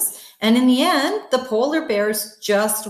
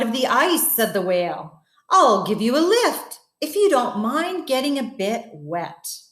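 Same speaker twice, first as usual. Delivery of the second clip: story narration. A narrator's reading. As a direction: tell it like a bedtime story. Reads story to children.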